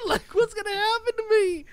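high, loud comic wail; panicked worry. Retold or imitated. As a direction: whine it in mock panic. Retold worry about being sued.

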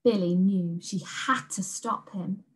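ordinary delivery

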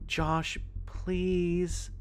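high, drawn-out plea; imploring, with a long high please; pleading. A narrator's reading. Sister pleading with brother in story.

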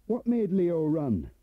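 called out high and slow; calling after. Acted. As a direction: call after him as he walks away. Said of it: "but Turner called after him".

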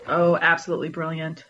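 normal talking voice

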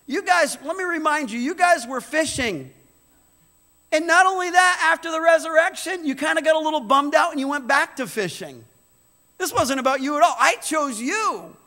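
loud, high, fervent preaching to the church; pulpit-pounding sermon voice; full-force preaching. Acted. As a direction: preach it out to the whole room.

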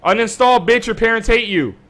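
high, loud trash-talk shout; taunting yell. Real, not acted. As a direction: shout the insult, gloating. Taunting a killed player in-game.

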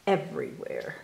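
plain reading voice

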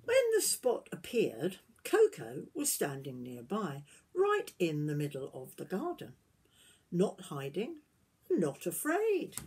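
gentle storybook reading voice; reading to children. Acted. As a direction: tell it like a bedtime story to little ones. Reading picture book aloud.

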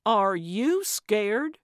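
slow, incredulous question with a big rise; disbelief. Acted. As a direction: stretch it out, unable to believe it. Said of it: "She couldn't believe it".

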